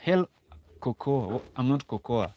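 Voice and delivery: usual speaking voice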